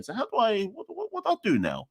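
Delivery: a lost tourist at a loss; lost and confused. Pretend. Imagining himself stranded at the airport, high bewildered mock voice. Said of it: "I'm gonna stand in the airport going".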